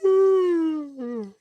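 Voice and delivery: long high yawning sigh; big yawn. Real, not acted. Streamer yawning late at night, long yawn. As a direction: let a yawn out mid-stream.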